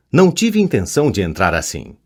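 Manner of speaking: plain reading voice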